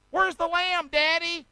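put-on high little-boy voice; Isaac as child. Son asking Abraham about the lamb, high childlike voice.

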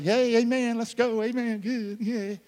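upbeat preacher self. Retold or imitated. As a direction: imitate your own eager "let's go, amen". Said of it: "I'm usually like".

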